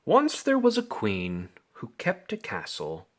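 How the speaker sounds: ordinary delivery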